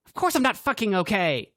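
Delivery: high, loud snapping retort; angry snap. A narrator's reading. Cassidy snapping at Grant, loud and angry. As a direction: snap it back, stung and furious. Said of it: "Cassidy snapped back".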